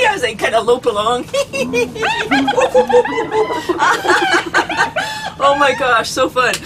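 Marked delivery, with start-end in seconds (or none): 1.3-5.4 high hyena cackle. Pretend. Hyena laugh continued.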